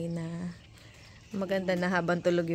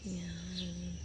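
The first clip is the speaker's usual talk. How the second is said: softer hushed tour talk at dawn; hushed morning voice; 2 semitones lower, 10 dB quieter than usual. Real, not acted. Filming the rooftop at sunrise while family sleeps, hushed. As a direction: keep it down so nobody wakes. Early morning, others asleep.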